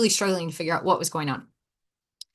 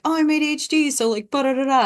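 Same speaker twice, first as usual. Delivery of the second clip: higher mocking sing-song voice; mock the excuse in a silly voice; mocking imitation; 7 semitones higher, faster than usual. Imitating people who blame ADHD, in a mocking voice. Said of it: "People are like".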